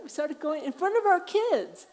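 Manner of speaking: laughing. Real, not acted. Laughing recalling the fight.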